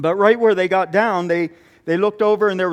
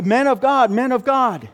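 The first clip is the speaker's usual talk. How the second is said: raised, higher calling voice from another room; 5 semitones higher than usual. Retold or imitated. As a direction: call out to the visitors, pleading. Old woman calling from hut.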